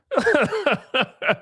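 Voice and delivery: high, amused laugh; laughing at the screenshot; real laughter. Real, not acted. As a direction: crack up. Laughing at the reply.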